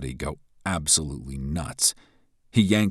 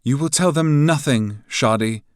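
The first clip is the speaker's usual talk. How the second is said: icy, commanding, higher and louder; cold voice; 10 semitones higher, 8 dB louder than usual. A narrator's reading. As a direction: cut him off coldly. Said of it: "a cold, flat voice".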